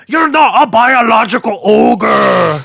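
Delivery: slow, loud Scottish ogre shout; Shrek voice. Pretend. Parodying sports debates, a shouted Shrek voice. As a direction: bellow it like an outraged ogre.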